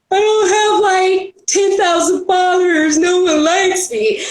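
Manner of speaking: hammed-up sad whine; mock self-pity whine. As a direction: wail it like a sulking kid throwing a pity party. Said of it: "I go throw my pity party".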